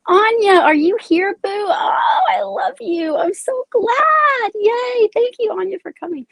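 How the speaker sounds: high-pitched, gushing delight; overjoyed to see a dear friend arrive; emotional greeting. Real, not acted. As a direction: squeal with affection, drawing out the joy. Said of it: "I got a little emotional right there".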